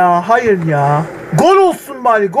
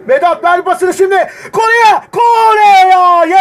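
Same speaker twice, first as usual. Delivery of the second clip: shouting at the screen; 6 semitones higher, slower than usual. Real, not acted. Gamer yelling at his striker to pass, shouting. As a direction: bark the demand at the player. Pass demanded in tense attack.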